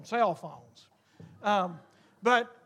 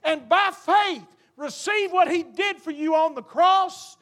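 higher, louder, shouting gospel climax; full force preaching; 6 semitones higher, 6 dB louder than usual. Acted. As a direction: preach it out with rising fire. Preacher builds to altar call.

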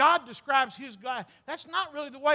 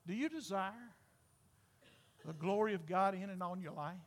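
ordinary talk, nothing marked

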